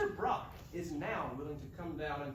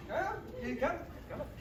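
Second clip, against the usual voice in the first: ordinary delivery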